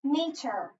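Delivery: "Meter" with the first syllable stressed; accented first syllable. Shown as a demonstration. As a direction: punch the start of "Meter", let the ending fall. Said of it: "So listen for the accent".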